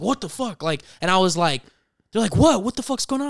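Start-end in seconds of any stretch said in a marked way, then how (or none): 0.0-0.6 startled grandpa. Retold or imitated. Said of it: "gets startled, and my grandpa's like". 2.4-3.3 high-pitched, bewildered mock outcry; startled confusion. Grandparents react to grandson's yell, startled and confused.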